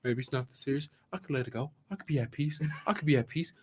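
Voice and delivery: soft, calming words to yourself; calming self-talk. Retold or imitated. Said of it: "I'm like".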